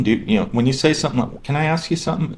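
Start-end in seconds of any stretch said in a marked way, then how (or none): 1.4-2.3 high, friendly ask; casual and nice, no pressure; sorta nice. Shown as a demonstration. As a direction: ask lightly, just being nice. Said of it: "sounds like you're just being sorta nice".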